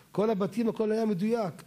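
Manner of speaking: usual speaking voice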